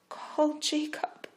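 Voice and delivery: high, slow, begging; weak plea. Acted. Dying Rachel begging for her husband, weak. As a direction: reach for him with no strength left. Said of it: "pleaded weakly".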